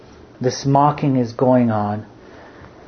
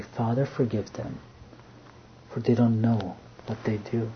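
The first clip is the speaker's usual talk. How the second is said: soft, much quieter plea of mercy; tender prayer; 9 dB quieter than usual. Acted. Jesus asking his Father to forgive, softly and tenderly. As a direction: pray it gently, forgiving them.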